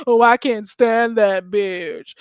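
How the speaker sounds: slow, loud mock voice; mocking imitation. Host mimicking the man playing victim, slow mocking imitation. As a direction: drag it out, mimicking his way of talking. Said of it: "I can't talk like him".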